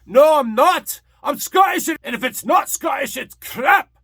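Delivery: loud, high-pitched ranting Scotsman; Mike Myers impersonation. Acted. Host ranting as a comic Scotsman, shouted impersonation. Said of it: "doing my best Mike Myers impersonation".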